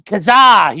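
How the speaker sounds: loud and high, calling out a name; loud name callout. Real, not acted. Host reading a viewer's chat, calls the name loudly. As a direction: belt the odd name out as you read it.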